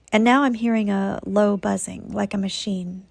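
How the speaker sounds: ordinary talk, nothing marked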